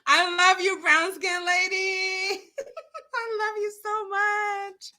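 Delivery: high, tearful love; choked up, laughing through tears; teary. Real, not acted. Emotional, near tears, addressing viewer.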